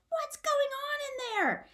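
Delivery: very high calling-out shout. Acted. Father shouting from elsewhere in the house. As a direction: call from another room. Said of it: "Izzy's father shouts".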